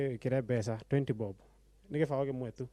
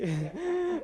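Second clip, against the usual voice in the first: short, very high-pitched laugh; embarrassed laugh. Real, not acted. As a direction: break into a brief high giggle. Contestant laughs while greeting wife.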